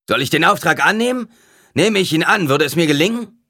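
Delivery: loud, high-strained questions; bellowing. A narrator's reading. Would-be killer asks if he will succeed, bellowing. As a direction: roar the questions at the oracle. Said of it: "brüllte eine Stimme".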